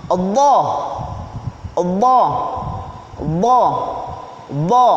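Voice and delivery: "Dha" thick and emphatic; heavy dha. Shown as a demonstration. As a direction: say "Dha" heavy, tongue tip out. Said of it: "Dha hampir sama dengan za".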